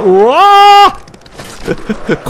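long high scream of fright; startled scream. Real, not acted. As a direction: scream as the monster jumps out. Jump scare mid sentence.

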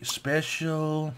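normal talking voice

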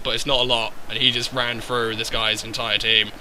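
ordinary delivery

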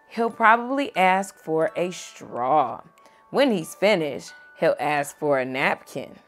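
ordinary delivery

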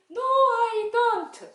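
very high, loud, drawn-out no; puppet child refusing. Pretend. Child puppet refusing vegetables, high and drawn out. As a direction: whine it out slowly like a stubborn kid.

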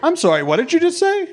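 high, stunned question; can't believe what he heard; shocked disbelief. Retold or imitated. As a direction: grandson stunned by grandpa's crude remark, high and incredulous. Shocked reply to grandpa.

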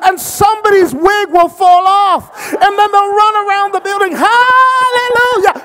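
high, full-volume church frenzy; hammed-up ecstatic holler; mock revival shouting. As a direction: yell it, building to a long held hallelujah. Acts out American church frenzy.

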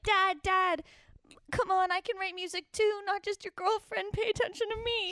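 high, loud plea for dad's attention; needy, high-pitched begging; pleading child. Acted. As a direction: whine at dad to notice you. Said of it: "me being like".

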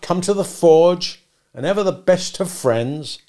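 a simple blacksmith pleading with feeling; earnest rustic Joe, voice rising with emotion. Acted. Joe's speech to Jaggers.

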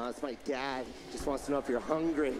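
put-on friend's accent. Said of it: "I was like".

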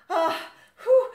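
ordinary talk

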